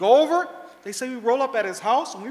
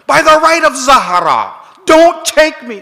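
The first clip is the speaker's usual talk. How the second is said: loud, slow desperate plea; old man pleading with the guards, loud and desperate; begging; 5 semitones higher, 12 dB louder, slower than usual. Retold or imitated. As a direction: beg by all that is holy. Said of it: "He starts begging him".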